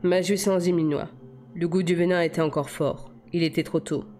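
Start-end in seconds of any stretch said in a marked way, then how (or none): none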